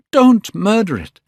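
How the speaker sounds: high, loud horrified plea; crying out. A narrator's reading. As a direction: cry out to save it. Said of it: "he cried".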